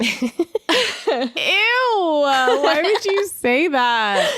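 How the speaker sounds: slow, high grossed-out groan; drawn-out disgusted whine. Real, not acted. Disgusted reaction to gross line.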